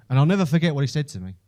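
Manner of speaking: usual speaking voice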